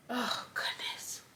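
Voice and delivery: a soft, broken whisper through tears; tearful whisper. Real, not acted. Overcome while telling viewers about the heartbeat, whispered. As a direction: breathe the words out as tears come. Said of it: "I need tissue".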